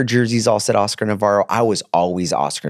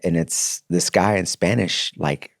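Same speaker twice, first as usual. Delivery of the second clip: ordinary talk, nothing marked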